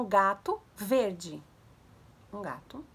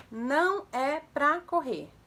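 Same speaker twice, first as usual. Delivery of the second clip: slow, louder, each word set apart; 4 semitones higher, 4 dB louder, much slower than usual. Shown as a demonstration. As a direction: spell the order out slowly, raised pitch. Repeats command word by word. (palavra por palavra, devagar e mais alto)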